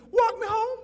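very high imitated girl's voice; high-pitched mock feminine voice; girl's request. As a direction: play a girl asking sweetly in falsetto. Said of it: "She said to me".

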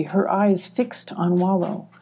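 plain reading voice

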